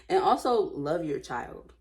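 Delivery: ordinary delivery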